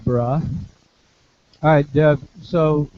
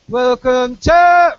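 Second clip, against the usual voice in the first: high shouted to, spread vowel; wrong yelled vowel; 10 semitones higher, 8 dB louder, much slower than usual. Shown as a demonstration.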